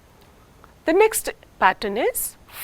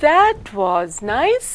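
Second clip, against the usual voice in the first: slow, fall on "That", rise on "nice"; divided fall rise; much slower than usual. Shown as a demonstration. Teacher demonstrating divided fall rise. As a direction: stretch it slowly, fall then rise. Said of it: "an example of a divided fall rise".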